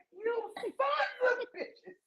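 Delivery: high, slow laughing mock shock; pearl-clutching outrage. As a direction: clutch your pearls in scandalised disbelief. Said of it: "I clutched my pearls".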